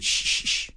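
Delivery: three short shushes; brisk repeated shh; shushing. Acted. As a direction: hush someone who is objecting. Aunt shushes the father.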